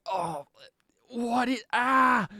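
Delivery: high-pitched startled cry, rising to a yell; mock alarm. Deafened woman reeling from the blast, startled cries. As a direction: act out a deafened yelp of shock.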